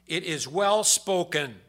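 loud, slow proclamation; proclaimed quote. Acted. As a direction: declare it slowly from the pulpit. Scripture quote read aloud.

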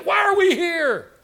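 high, slow whiny wail; loud, high, slow mock whine; whining complaint. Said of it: "whining and complaining".

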